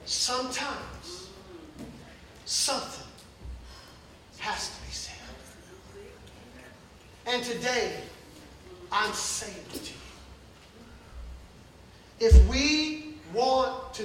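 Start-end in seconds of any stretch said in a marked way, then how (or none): 0.1-10.0 soft, near-whispered warning; hushed preacher. Acted. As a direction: drop to a whisper to make them lean in. Sudden hush in sermon.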